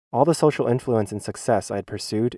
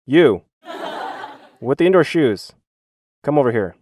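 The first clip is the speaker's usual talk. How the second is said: loud, raised call of a stern teacher; strict teacher's yell; 4 semitones higher, 5 dB louder than usual. Retold or imitated. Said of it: "become a strict teacher and yell".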